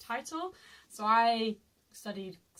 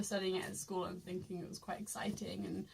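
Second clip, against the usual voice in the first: whisper; 3 semitones lower, 8 dB quieter than usual. Real, not acted. Whispered aside.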